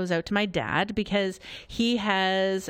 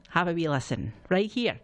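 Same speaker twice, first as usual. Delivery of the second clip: put-on Scottish accent; 3 semitones lower than usual. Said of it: "which I can somewhat duplicate".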